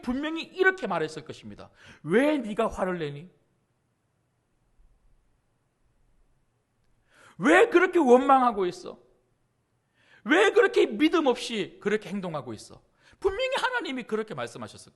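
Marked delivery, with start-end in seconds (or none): 2.0-12.8 acted stern rebuke, pausing between questions; mild mock scolding. God hypothetically rebuking Gideon for his complaint, mildly scolding. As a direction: scold as an imagined displeased God, restrained. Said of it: "화를 내듯이".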